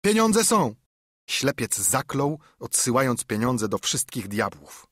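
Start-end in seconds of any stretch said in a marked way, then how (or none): 0.0-0.8 very high, loud call; high-pitched shouted reply; shouting back. A narrator's reading. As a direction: holler the answer down to the street. Robber shouts back from the window.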